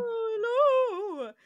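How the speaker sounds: high, loud call of a name; calling Sulu. Retold or imitated. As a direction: shout across to Sulu. McCoy calling out.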